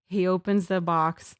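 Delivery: ordinary talk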